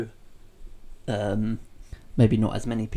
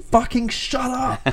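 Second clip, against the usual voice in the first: much higher, louder, slow furious bark; Vince yelling; 13 semitones higher, 7 dB louder, slower than usual. Retold or imitated. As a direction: blow up backstage like a fed-up boss. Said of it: "maybe Vince backstage or something just going".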